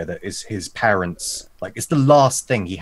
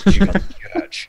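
mock whisper; 12 semitones higher, 7 dB louder, faster than usual. GM retorts in character.

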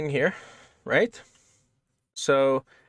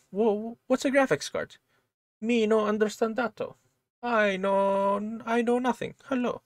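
much higher confused broken-English voice; unplaceable mock accent; 8 semitones higher than usual. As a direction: play a baffled foreigner with no idea. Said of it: "accent or language that was".

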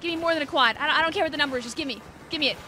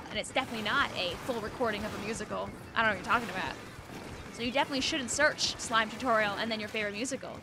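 ordinary talk, nothing marked

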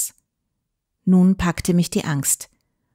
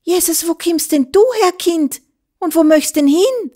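much higher, shocked exclamation; frightened woman calling out; startled cry; 10 semitones higher than usual. A narrator's reading. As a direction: gasp it out on finding the child. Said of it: "rief sie erschrocken".

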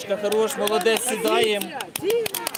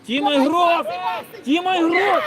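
yelling from the sidelines; 6 semitones higher, 5 dB louder than usual. Real, not acted. Coach yelling during kids' match.

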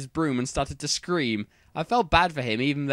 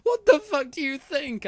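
high-pitched angry retort; 13 semitones higher than usual. A narrator's reading. Ebony answering Bloody Mary, angry. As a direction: fire back in a high, furious voice. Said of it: "I asked angrily".

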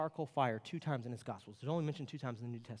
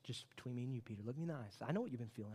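usual speaking voice

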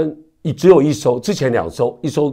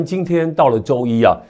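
ordinary delivery

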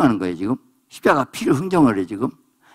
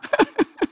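high-pitched burst of laughter; laughing. Real, not acted. Preacher laughs after acted quote. (높은 소리로 터뜨리는 웃음)

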